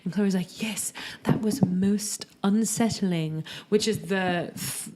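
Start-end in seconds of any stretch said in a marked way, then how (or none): 0.6-3.4 put-on slow whispered British accent. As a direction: murmur it, prim British accent. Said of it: "most British thing she said".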